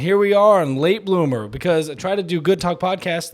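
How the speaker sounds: excited. Real, not acted. Loud show opening.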